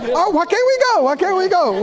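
high-pitched mock complaint; imitating complaining passengers. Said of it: "have you heard people talk like this?".

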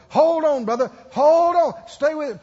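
loud, high coaching holler; shouted urging. Retold or imitated. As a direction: egg him on at full voice. Acts helpers urging him on.

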